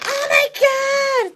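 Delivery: high, drawn-out shriek; hammed-up hysterical scream; yelling. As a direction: flip out in a shrill yell. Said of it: "just yelling".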